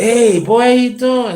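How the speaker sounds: mock jolly uncle; hearty uncle greeting. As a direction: hail the nephew with big cheer. Uncle Pete greets him.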